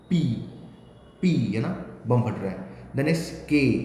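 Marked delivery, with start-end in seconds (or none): 0.1-1.3 पी released from closed lips in a burst; plosive P demo. Shown as a demonstration. Teacher demonstrating the bursting पी for the class. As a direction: let पी pop off the lips. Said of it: "इस बार आप lip से रोकने वाले हैं".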